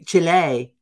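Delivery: CHILE as a loud "CHEE-lay" in a mock Spanish accent. Host mocking news readers, overdone Spanish accent. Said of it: "they have to say it like that".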